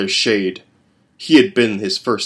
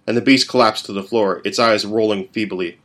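ordinary delivery

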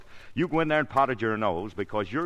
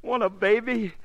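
high, loud elated exclaiming; overjoyed; 8 semitones higher, 5 dB louder than usual. Real, not acted. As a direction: bubble over with joy at the news. Husband thrilled at baby news.

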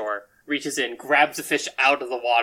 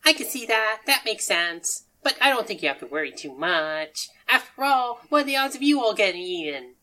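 higher, lilting voice for an odd little figure; cheerful odd character; 9 semitones higher, 5 dB louder than usual. Acted. Game master as Sushi Kid reassuring players, cheerfully odd. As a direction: talk it away in a raised singsong pitch.

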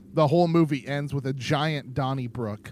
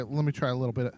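ordinary delivery

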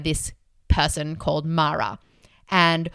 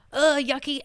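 higher, mock disgust; disgusted ugh; 7 semitones higher than usual. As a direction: recoil from a yucky feeling. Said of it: "I'd be like".

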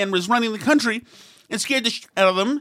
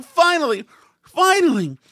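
mocking relief; 7 semitones higher, 4 dB louder than usual. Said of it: "People are saying".

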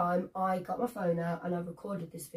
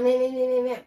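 louder mocking meh-meh jabber; mock moaning; 3 semitones higher, 6 dB louder than usual. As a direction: parrot his moaning like a nagging noise. Mocking the other driver's moaning.

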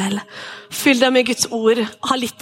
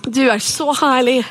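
worship prayer. Acted. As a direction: lead the room in prayer. Leads the congregation in prayer. (inderlig forbønn)

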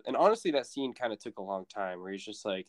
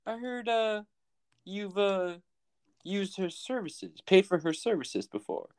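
higher, halting acted inquiry; hesitant mock questioning; 8 semitones higher than usual. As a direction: feel your way into an awkward question, pitched up. Acting the sheriff's delicate question.

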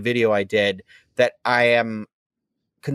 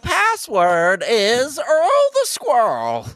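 put-on high, drawn-out old-time character voice; nineteen-twenties voice; 10 semitones higher, much slower than usual. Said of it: "do this nineteen twenties voice".

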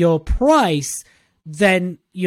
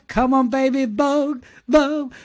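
playful [name]; 7 semitones higher than usual. Acted. Singing [name] [name] playfully.

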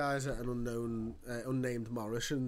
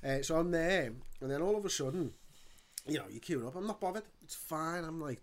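ordinary delivery

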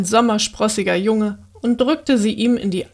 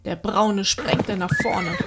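usual speaking voice

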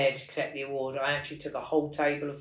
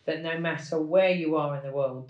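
ordinary delivery

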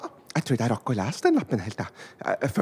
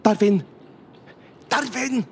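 loud high call for a lost friend; 9 semitones higher, 4 dB louder, slower than usual. Pretend. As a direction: shout his name into the mall. Calling for Darwin inside.

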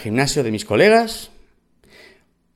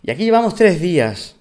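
higher-pitched mock voice of the squatter woman; quoting the squatter woman in a put-on higher voice; mimicking the woman; 8 semitones higher than usual. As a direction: imitate her cheeky claim in a higher voice. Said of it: "Me dice".

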